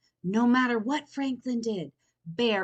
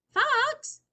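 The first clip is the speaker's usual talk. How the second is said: much higher and louder call; crying out; 7 dB louder than usual. A narrator's reading. Franklin crying out to Fox in dismay. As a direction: cry out in dismay. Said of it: "cried Franklin".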